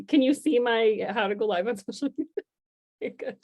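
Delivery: ordinary talk, nothing marked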